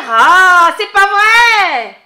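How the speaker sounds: loud, slow groan of disbelief; loud, drawn-out exasperation; exasperated. Real, not acted. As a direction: throw up your hands in despair. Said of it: "exasperated listener".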